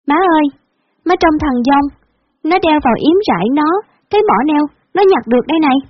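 put-on high child voice calling mom; excited child. Girl running to her mother with her brother, high excited child voice. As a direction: sing out to mom like a little girl.

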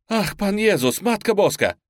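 a woman crying out in despair; desperate cry. A narrator's reading. A frightened woman crying out at the gunshots, desperate cry. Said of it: "отчаянный женский крик".

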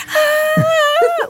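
high-pitched sustained mock scream; imitated terrified scream. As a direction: act out screaming bloody murder. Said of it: "I actually just screamed the whole way".

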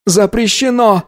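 high insistent cry; boy's outcry. Acted. Boy repeats ban, near collapse.

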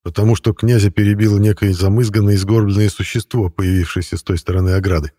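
ordinary delivery, nothing marked

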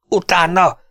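high, loud command to chase; shout. A narrator's reading. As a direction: yell after the fleeing rider. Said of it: "kiáltotta Lindsay".

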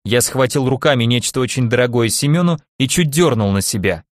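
usual speaking voice

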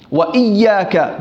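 "وإياك" slowed on the double y; careful doubled y in "وإياك"; with shadda. Shown as a demonstration. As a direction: hold the y in "وإياك", wa iyyaaka. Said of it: "بالتشديد صح".

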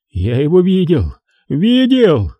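rising to a high hysterical cry; insist, voice cracking with hysteria. Acted. Хеннесси в истерике убеждает Дантека, истерический крик. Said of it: "Его голос приобрел истерические нотки".

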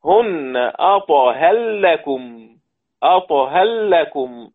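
أطهر لكم slow, the final R of أطهر merged into a doubled L, "atahallakum"; slow Quran recitation merging the R into the L. Shown as a demonstration. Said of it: "إضغام الراء في اللام".

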